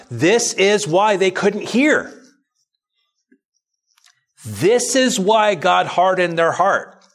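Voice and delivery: high, slow, emphatic preaching voice. Acted. Pastor preaching to his congregation, slow and emphatic. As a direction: drive each point home to the congregation.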